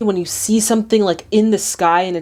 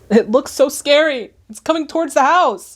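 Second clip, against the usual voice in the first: raised, alarmed viewer pitch; freaking out; 7 semitones higher than usual. Real, not acted. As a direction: react higher, freaked out by what's on screen. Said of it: "I don't know why I'm freaking out".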